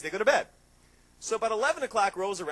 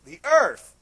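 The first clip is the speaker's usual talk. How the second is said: The earth, much louder and punched; emphatic shout; 3 semitones higher, 13 dB louder than usual. Real, not acted. To the audience, preaching the earth's importance, loud. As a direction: hammer The earth home loudly.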